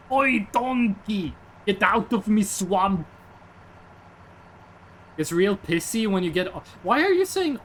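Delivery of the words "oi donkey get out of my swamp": Shrek voice. Retold or imitated. Shrek shouting at donkey, slow Scottish ogre voice.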